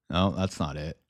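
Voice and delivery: ordinary talk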